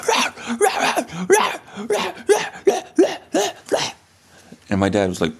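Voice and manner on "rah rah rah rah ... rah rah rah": high, yappy barks like a spooked dog; imitated dog barking. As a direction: bark it out like a frightened little dog. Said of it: "And he was like".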